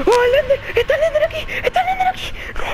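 very high, wailing panic; terrified. Real, not acted. Player spotting Slender right there, terrified squeal.

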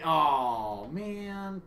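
deflated aw man; disappointed sigh. Real, not acted. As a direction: groan it out, let down. Bad luck in the game.

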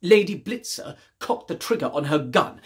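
usual speaking voice

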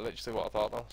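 ordinary delivery

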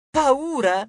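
high-pitched taunting echo; pretend-scared squeal; mocking echo. A narrator's reading. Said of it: "gli fece eco Passalo".

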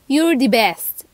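normal talking voice

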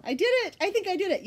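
high, loud burst of triumph; excited. Real, not acted. Podcaster celebrating a tongue twister said right, excited. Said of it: "I'm so excited I said it right".

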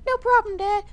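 put-on very high falsetto child; squeaky kid voice. Kid sending dad off on the swing, squeaky kid voice. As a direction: pipe up in a tiny falsetto. Voicing Timmy answering dad.

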